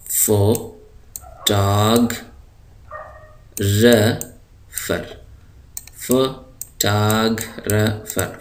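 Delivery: फोटोग्राफर stretched out very slowly, stress on the second syllable; teacher's model word. Shown as a demonstration. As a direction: draw out फोटोग्राफर. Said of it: "English का ये सही लफ्ज़ है फोटोग्राफर".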